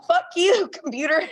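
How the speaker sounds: high-pitched burst of acted frustration; mock phone rage. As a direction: curse out the automated system. Acted cursing at automated phone line.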